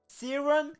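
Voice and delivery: ordinary delivery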